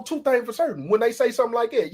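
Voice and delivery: usual speaking voice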